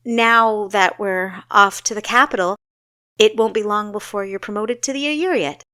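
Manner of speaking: proud congratulations. Acted. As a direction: gush over her sister's success. Kessa congratulating her sister.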